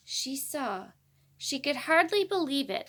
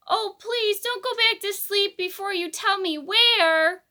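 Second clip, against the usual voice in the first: much louder, higher begging; an anxious mother begging the owl; pleading shout; 8 semitones higher, 10 dB louder than usual. A narrator's reading. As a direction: plead loudly before he sleeps. Katy shouts to the deaf owl.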